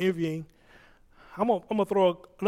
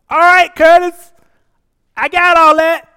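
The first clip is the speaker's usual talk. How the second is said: much louder, higher, slowed and booming; Holy Spirit's voice; 11 semitones higher, 17 dB louder than usual. Retold or imitated. Holy Spirit cutting off the preacher's prayer list, loud and high. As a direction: boom it out like God interrupting. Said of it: "the Holy Spirit says".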